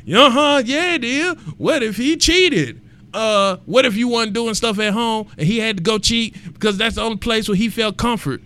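high, sassy mock voice of a doubter; sarcastic imitation of a naysayer; mocking critic. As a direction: parody the listener who argues back. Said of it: "Somebody's out there like".

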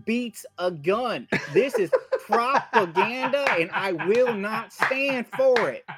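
ranting conspiracy-minded right-wing caller voice; hammed-up caller character. Caller railing to a podcast host about corn-worshipping youngins, hammed-up indignation. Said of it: "podcast in that voice".

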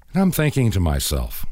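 usual speaking voice

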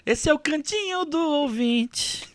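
announcer voice. Acted. Announcing the listener segment.

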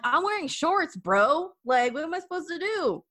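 high, exasperated retort; indignant comeback. Retold or imitated. Daughter snapping back at her dad over her dress, high and exasperated. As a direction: brush him off like the fuss is absurd.

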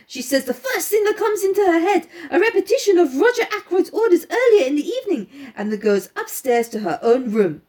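lightly voiced French-accented detective; Poirot. Poirot laying out the girl's movements for his companion, lightly French-accented. Reads Poirot's explanation aloud.

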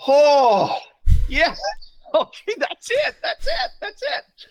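laughing, very high and loud cry of joy; joyful outburst. Real, not acted. Pastor telling the host his story, high laughing joy. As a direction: overcome with delight, laughing and crying.

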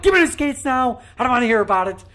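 high, loud mock shout; shouted demand. [name] repeating his skates demand to the media person, shouting. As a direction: yell it like you're fed up. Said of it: "I'm like".

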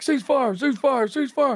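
urgent shout to stop a firefight; yelling. Retold or imitated.

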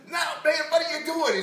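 high, loud mock dismay; exasperated shout. King to the prophet who keeps blessing, exasperated shout. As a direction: cut in with an exasperated yell.

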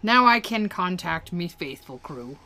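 hearty pirate reading; put-on pirate accent. Reading a game pirate's thanks from saved dialogue, hearty pirate voice. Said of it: "so here he says".

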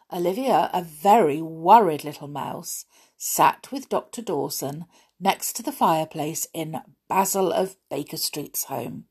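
ordinary delivery, nothing marked

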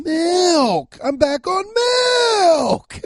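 high, loud, drawn-out shout on milk; excited shouting. Real, not acted. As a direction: belt it out, thrilled to be on a mic again. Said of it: "so now I'm just fucking screaming".

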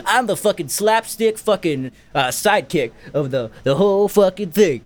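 lightly mocking sidekick voice; hammy impression of an annoying cartoon sidekick; slightly put-on comic cub voice; slapstick sidekick. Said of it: "And he's like".

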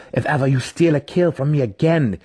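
spat threat. A narrator's reading. Driz threatening the drow who stole his kill, spat. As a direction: spit out the threat, voice changed. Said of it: "he spat".

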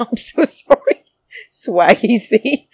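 can't hold back the laugh while apologizing; laughing apology. Real, not acted. Host laughing at herself for botching Swaggy C's name.

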